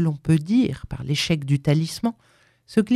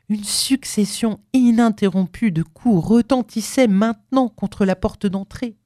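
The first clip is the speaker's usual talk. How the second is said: ordinary delivery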